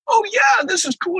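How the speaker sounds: gleeful, high, fanboy delight; excited fanboy. Retold or imitated. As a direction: replay the fan's thrill at first sight, way up high. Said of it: "I'm like".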